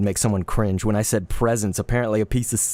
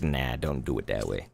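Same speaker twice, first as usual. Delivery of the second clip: mock low voice; 11 semitones lower, 8 dB quieter than usual. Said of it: "So they were like".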